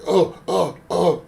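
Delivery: hammed-up high loud moaning; playful fake sex moans; mock moans. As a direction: fake it loudly as a joke. Faking sex noises shaking bed.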